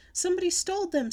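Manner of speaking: very high, loud cry for help; hollering. A narrator's reading. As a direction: holler for emergency help in a panic. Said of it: "I hollered".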